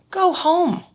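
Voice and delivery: a high insistent push to just go home; high urging go home. Retold or imitated. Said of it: "And I was like".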